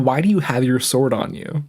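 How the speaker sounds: usual speaking voice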